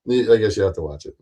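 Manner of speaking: ordinary talk, nothing marked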